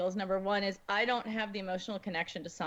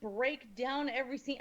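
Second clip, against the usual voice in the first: ordinary talk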